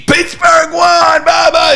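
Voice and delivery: high, drawn-out victory call; mock announcer. Announcer calling the end of the game, mock shouted victory cry. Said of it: "at the end of the game".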